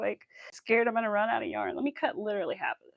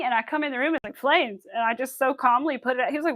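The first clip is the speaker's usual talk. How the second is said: ordinary talk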